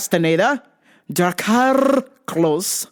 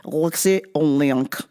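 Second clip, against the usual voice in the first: lower, put-on Russian accent; 4 semitones lower than usual. Podcaster correcting his reading of the fighter's name, Russian accent. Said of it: "let me turn on my Russian accent".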